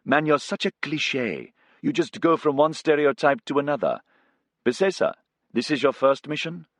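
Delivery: teasing his mate with a grin; Abdikadir the co-pilot. Acted. Co-pilot Abdikadir ribbing Casey to Bisesa, amused.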